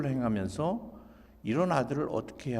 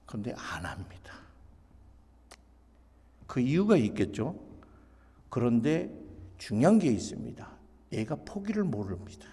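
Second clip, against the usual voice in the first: ordinary delivery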